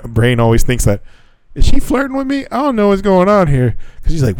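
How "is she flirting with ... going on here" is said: high, puzzled inner voice; high-pitched what's-going-on voice; mock wondering. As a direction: squeak out the doubt. Inner voice of male brain.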